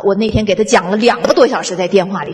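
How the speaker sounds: normal talking voice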